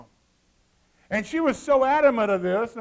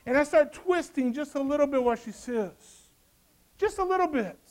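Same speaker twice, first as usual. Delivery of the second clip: sly tempter; 4 semitones higher than usual. Pretend. Preacher voicing sly twisting tempter.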